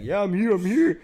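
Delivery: put-on high, loud drunk insistence; a drunk friend insisting on the phone; imitating drunk friend. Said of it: "and he's like".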